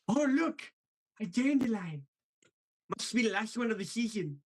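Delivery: high, lisping cartoon sloth voice; Sid the Sloth. Voice actor showing the chat his newest impression, Sid the Sloth voice. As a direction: do the goofy sloth from Ice Age. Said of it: "was Sid the Sloth".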